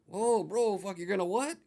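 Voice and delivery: replaying his stunned reaction to the owner; retold shock. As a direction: react as if you can't believe the threat. Shocked at the threat.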